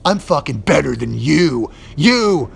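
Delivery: hammed-up smug put-down; mock smug boast. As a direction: sneer it like a smug anchor. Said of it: "And you look at the camera".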